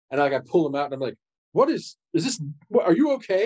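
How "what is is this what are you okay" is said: high, flustered questions; worried. Acted. Son checking his father for wounds, worried. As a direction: fuss over him, alarmed.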